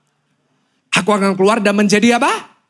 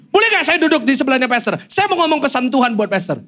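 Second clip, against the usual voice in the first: higher-pitched, lightly voiced woman; imitated eager woman; 8 semitones higher than usual. Woman asks to sit beside the pastor, eager. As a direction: mimic an eager stranger asking to join.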